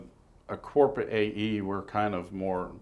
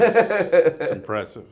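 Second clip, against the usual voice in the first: laughing; 7 semitones higher, 8 dB louder, much slower than usual. Real, not acted. Amused reply about big show.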